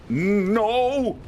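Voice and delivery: sharp high yelp of alarm; panicked no. Real, not acted. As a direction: cry out in sudden panic. Panicked cry during a chase.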